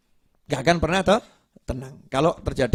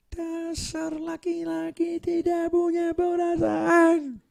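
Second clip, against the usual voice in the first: high, slow, mock tearful wife's wail; pretend crying wife; slower than usual. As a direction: play the wife faking tears to win the fight. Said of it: "pura-pura nangis". (istri pura-pura menangis dengan suara tinggi dan lambat)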